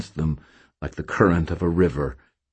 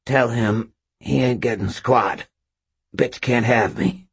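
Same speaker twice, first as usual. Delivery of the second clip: put-on drunkenly slurred defiance; 6 semitones higher than usual. A narrator's reading. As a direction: let the words smear together. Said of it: "Morty said in a slurred voice".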